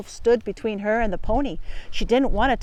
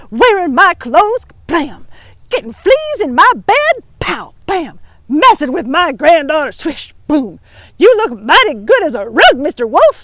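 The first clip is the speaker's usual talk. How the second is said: loud, high fierce scolding; angry granny; 6 semitones higher, 10 dB louder than usual. Acted. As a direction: scold the wolf while fighting. Grandma beating the wolf.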